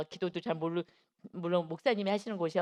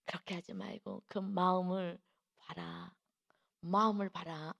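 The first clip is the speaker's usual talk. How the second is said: slow, hushed, low-key instruction; Holy Spirit's voice; 3 semitones lower, 6 dB quieter, slower than usual. Retold or imitated. Holy Spirit correcting the teacher, hushed slow voice. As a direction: softly voice an inner divine prompting, unhurried. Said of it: "성령님의 음성이 들린 거예요".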